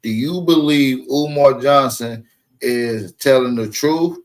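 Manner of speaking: ordinary talk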